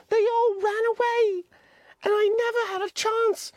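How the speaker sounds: put-on high, pleading boy voice; high-pitched anxious boy Piggy; Piggy's voice. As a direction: voice the fretful schoolboy Piggy, pitched well up. Piggy protests.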